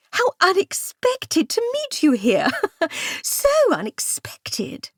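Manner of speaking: high, slow, gushing with a breathy laugh; fake delight. A narrator's reading. Primula feigning joy at her rival, high and slow. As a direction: drip false sweetness, drawing it out.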